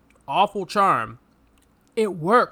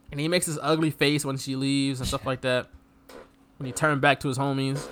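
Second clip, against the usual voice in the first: usual speaking voice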